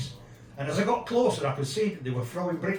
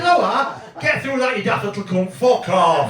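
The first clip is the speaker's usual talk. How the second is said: higher and louder, blunt and laughing; retold telling-off; 3 semitones higher, 7 dB louder than usual. Squaring up to the scally, a loud laughing telling-off. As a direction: tell the little scally to clear off. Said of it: "I said".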